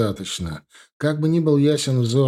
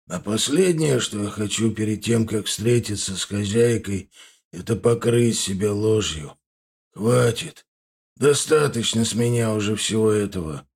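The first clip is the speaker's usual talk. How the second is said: put-on old grandfather; 3 semitones lower than usual. A narrator's reading. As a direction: speak like a weary old grandfather. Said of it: "ответил дедушка языком людей". (медленный голос старого деда)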